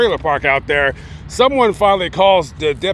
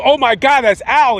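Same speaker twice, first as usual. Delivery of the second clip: higher, louder retold outburst of horror; mock distressed cry; 7 semitones higher, 4 dB louder than usual. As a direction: imitate someone crying out on finding a friend dead. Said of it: "he's, like, screaming and crying".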